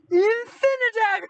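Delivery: high, loud Infinidagger battle cry; loud mock battle cry; Game Grumps bit. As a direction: yell it like a cartoon hero. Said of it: "his voice being like".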